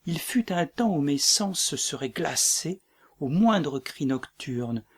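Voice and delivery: ordinary talk, nothing marked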